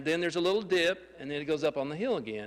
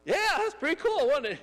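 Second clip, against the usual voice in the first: much higher and louder, buzzing with delight; giddy thrill; 11 semitones higher, 4 dB louder, faster than usual. Retold or imitated. To the shocked pastor after the fall, a giddy thrill. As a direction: sell it like the best ride ever. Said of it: "and I was like".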